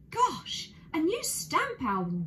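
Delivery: put-on a boy delighted by his present; gleeful, high "Gosh!" of a child; excited cry. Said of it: "cried Jonathan".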